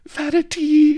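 high loud squeak. Acted. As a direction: squeak her name in alarm. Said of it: "she squeaked".

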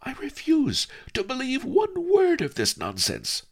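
put-on girl shouting her refusal in anger; angry refusal. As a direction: stamp and refuse it outright. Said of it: "she said angrily".

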